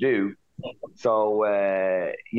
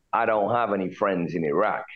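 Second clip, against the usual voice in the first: ordinary delivery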